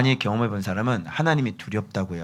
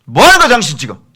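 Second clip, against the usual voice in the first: loud, raised scolding demand; stern rebuke; 9 semitones higher, 13 dB louder, faster than usual. Shown as a demonstration. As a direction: challenge the wrongdoer at high volume. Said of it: "어미 꾸짖으라". (엄하게 다그치며 꾸짖듯)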